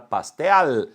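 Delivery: "pastel" closed on a clear, held L; "pastel" with a real L, not a U sound; consonantal final L. Shown as a demonstration. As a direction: let the tongue block the end of "pastel". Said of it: "O L é uma consoante".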